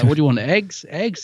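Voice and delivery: high, baffled questions; puzzled questioning. Retold or imitated. Quoting Rory with the Dalek.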